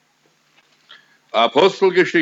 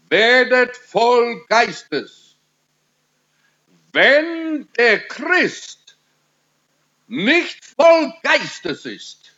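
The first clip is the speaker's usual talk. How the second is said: high, drawn-out exhortation; forceful preaching; 6 semitones higher, slower than usual. Real, not acted. Preacher urges Christians to be filled with the Spirit, forceful preaching. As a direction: press the command on them in a raised pitch.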